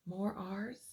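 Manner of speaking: usual speaking voice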